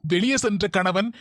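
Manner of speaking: soft, measured reading of a tips list; reading tips aloud. Acted. Husband reads marriage tips to his wife, in a formal reading voice. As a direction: read the tips like a formal article.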